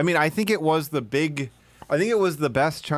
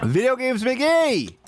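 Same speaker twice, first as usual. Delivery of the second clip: calling out; 12 semitones higher, 3 dB louder, slower than usual. Real, not acted. Calling out to co-host.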